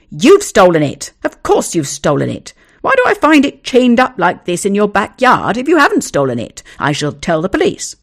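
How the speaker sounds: loud, furious accusing; angry accusation. Acted. Owner accusing neighbour of stealing his dog, loud and angry. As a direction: shout him down as a thief. Two men quarrel over dog.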